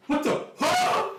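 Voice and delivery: high, yelping shock; jolted upward and caught off guard; startled elf. Acted. Elf flung skyward by reverse gravity yelps in high surprise. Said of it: "and you hear".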